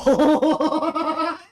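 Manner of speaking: high loud burst of laughter; laughing out loud. Real, not acted. Laughing at the joke.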